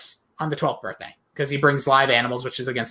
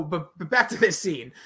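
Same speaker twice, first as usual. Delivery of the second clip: ordinary delivery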